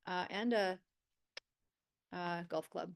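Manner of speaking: ordinary delivery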